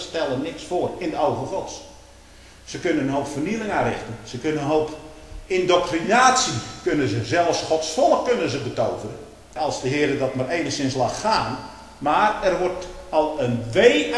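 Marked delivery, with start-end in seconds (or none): none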